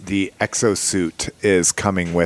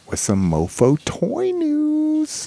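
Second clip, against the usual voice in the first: announcer voice; slower than usual. Acted. Announcing the break, jingle-like.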